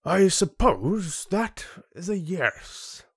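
slow, high-pitched lordly drawl; haughty chieftain. Acted. Chieftain to cowed land dweller, haughty. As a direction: draw it out like a condescending ruler.

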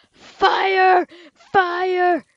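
gleeful cry of fire; yelled fire. Real, not acted. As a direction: shout it as he burns it. Lighting a mob on fire.

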